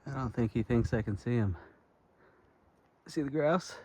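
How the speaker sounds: quiet voice so the bird stays; hushed. Real, not acted. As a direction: hush it, keep the bird calm. Sneaking up on a grouse.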